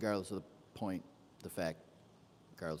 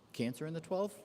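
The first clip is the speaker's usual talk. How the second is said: surprised; 10 semitones higher than usual. Real, not acted. Surprised question at chart.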